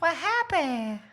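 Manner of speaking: very high, slow, stretched squeak; mock squeaky voice. Host parroting the outburst, slow squeaky mock voice. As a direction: draw the question out in a silly squeak.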